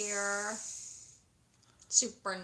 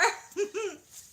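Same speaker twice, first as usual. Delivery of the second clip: laugh; 11 semitones higher than usual. Real, not acted. Laughing at own joke.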